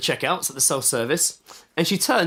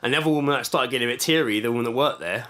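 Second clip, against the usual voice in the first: ordinary talk, nothing marked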